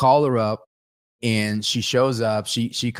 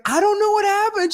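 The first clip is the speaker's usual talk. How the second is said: mortified; 3 dB louder than usual. Retold or imitated. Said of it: "She's like".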